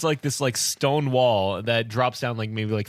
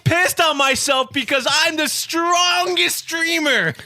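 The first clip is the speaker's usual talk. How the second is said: shouted high and slow, proudly; boastful shout; 13 semitones higher, 5 dB louder, slower than usual. Acted. Shouting his boast at friends who mock him, loud and proud. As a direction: yell it like a champion's boast.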